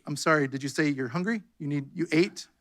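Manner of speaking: soft, innocent question; mock mishearing. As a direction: playfully pretend to mishear. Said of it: "We're just gonna be like".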